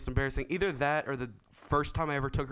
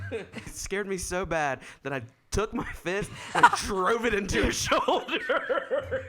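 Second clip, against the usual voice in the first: high, laughing confession; laughing while telling; 8 semitones higher, 3 dB louder, slower than usual. Real, not acted. Confessing he punched her shoulder by accident, laughing hard. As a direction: crack up through the words, drawing out the end.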